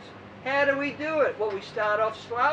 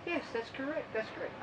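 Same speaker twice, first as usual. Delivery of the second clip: much softer, nodding yes; mock agreeing scientists; 11 dB quieter than usual. Sports scientists nodding along, softly agreeing. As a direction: murmur it like nodding experts. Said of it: "they'll all nod their head and say".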